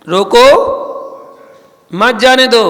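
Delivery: रोको on its own, rising, long pause, then मत, जाने दो; pause after रोको. Shown as a demonstration. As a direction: break right after रोको, then run मत, जाने दो together. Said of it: "रोको के बाद कॉमा लगाओ".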